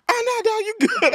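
squeaky high teasing voice; high mocking voice. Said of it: "He was like".

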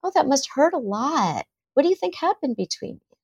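put-on high-pitched, tender sympathy for a child; gentle empathy. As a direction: softly invite the hurt child to explain. Models an empathetic parent's reply.

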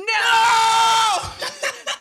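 high squealing laugh burst; a sudden delighted shriek of laughter. Real, not acted. Loud burst after "No!.

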